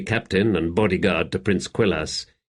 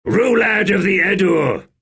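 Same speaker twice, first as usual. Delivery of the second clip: much higher, louder battle shout; shouted challenge; 11 semitones higher, 5 dB louder than usual. A narrator's reading. Warrior at the bridge calls out Rulad, a loud shouted challenge. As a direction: bellow the challenge across the bridge. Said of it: "he shouted".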